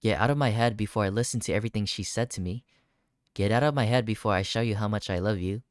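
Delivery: usual speaking voice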